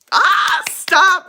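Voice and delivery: very high, loud, giddy squeal; flattered squeal. Real, not acted. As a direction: shriek in delighted embarrassment.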